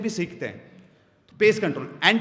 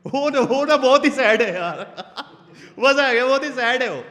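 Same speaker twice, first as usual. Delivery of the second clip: higher, louder, laughing through it; mock-sad with laughs; 4 semitones higher, 7 dB louder than usual. Shown as a demonstration. Speaker demos playing with emotion, laughing and louder. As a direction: ham up the sad word while laughing. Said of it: "words के साथ emotion को खेलो".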